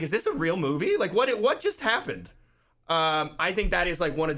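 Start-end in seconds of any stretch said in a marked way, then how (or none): 0.0-2.3 pitched up high in disbelief; stunned, can't believe the movie; recalling his shock at the first John Wick; amazed disbelief. Retold or imitated. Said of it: "I was like".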